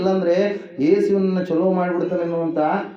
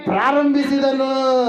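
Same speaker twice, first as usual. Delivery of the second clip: usual speaking voice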